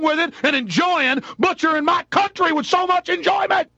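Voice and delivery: furious yelling at the police; furious rant. Real, not acted. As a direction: rage boiling over into a shout. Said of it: "I, I, I'm too angry".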